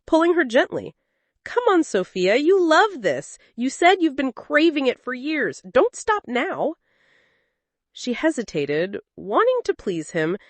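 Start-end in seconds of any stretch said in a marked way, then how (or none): 1.4-6.8 higher, teasing and coaxing; playful coaxing man. A narrator's reading. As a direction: coax her on, lightly laughing. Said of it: "Richard laughed".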